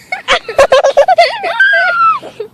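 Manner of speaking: high screaming laughter; shrieking laugh. Real, not acted. Sour challenge, shrieking laugh.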